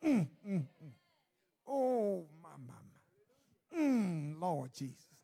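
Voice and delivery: low wordless moans between sighed pleas; moaning prayer. Retold or imitated. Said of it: "Just, just that moan".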